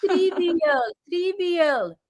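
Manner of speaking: lightly mocking, high "Trivial" with a falling end; mock pronunciation. Mimicking another person's mispronounced word.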